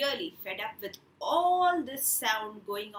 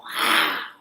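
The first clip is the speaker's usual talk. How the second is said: tiger roar; 10 dB louder than usual. Retold or imitated. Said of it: "So the tiger will go".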